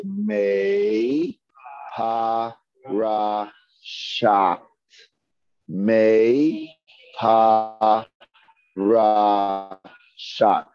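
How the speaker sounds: each syllable held and set apart; slow, syllable by syllable. Shown as a demonstration. Teacher leading the class in saying it together, slowly, syllable by syllable. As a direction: draw out every syllable with gaps between. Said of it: "Let's say this together slowly".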